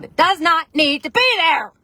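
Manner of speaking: sudden high, loud outburst; losing it, shouting. Acted. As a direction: build to an emphatic yell. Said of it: "I'm sorry if you had headphones in. I just lost it".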